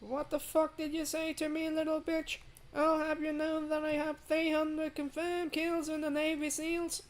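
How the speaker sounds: squeaky high voice hamming up a threat as James; James impression. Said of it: "That's my best James impression".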